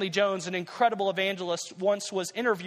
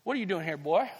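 lightly put-on country accent. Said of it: "say it in a country accent".

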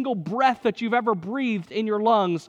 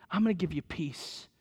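much softer, low and tender; tender promise; 3 semitones lower, 7 dB quieter than usual. Retold or imitated. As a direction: breathe the promise of peace. God promising Moses peace.

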